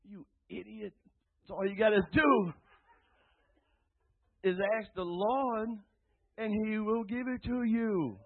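high, loud exasperated telling-off; frustrated self-scolding. Retold or imitated. A man frustrated with himself, scolding himself high and loud. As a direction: scold yourself like a fool who forgot the obvious. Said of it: "I find myself getting frustrated".

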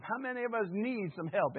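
ordinary talk, nothing marked

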